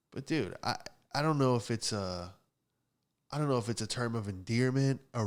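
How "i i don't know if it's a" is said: ordinary talk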